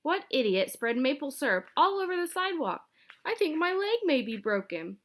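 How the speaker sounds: high, indignant scolding; high indignant rant; angry old lady. A narrator's reading. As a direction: scold the culprit. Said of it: "shouted Missus Mintry angrily".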